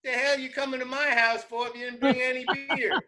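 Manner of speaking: high, fast hillbilly rant; high indignant country yelling; mock outrage. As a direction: scold them for no beer, hammed up. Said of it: "he starts yelling at him".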